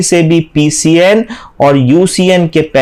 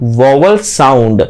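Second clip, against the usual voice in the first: stretched slowly; 5 semitones lower, much slower than usual. Shown as a demonstration. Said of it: "teacher stresses key term".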